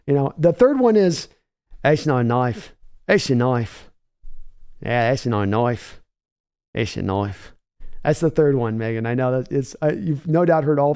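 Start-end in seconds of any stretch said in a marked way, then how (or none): 1.8-7.5 low, put-on Australian accent. Streamer teasing Megan with the famous Australian line, playful Australian accent. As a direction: try out a low Aussie accent with glee. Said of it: "has to try out their Australian accent".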